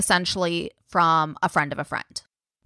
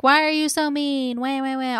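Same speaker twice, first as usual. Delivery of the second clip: mocking whiny crying, higher pitched; 6 semitones higher than usual. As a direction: whine it like a fake sob. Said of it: "starts crying and being like".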